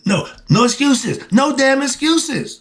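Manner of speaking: real irritation building to an outburst; annoyed. Real, not acted. Preacher to listeners, annoyed at single-parent excuses, rising outburst. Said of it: "I get annoyed with that".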